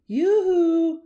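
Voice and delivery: high, loud flirty yoo-hoo; loud sing-song hail; sing-song call. A narrator's reading. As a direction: call out high and sweetly to the owl. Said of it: "called to the great horned owl".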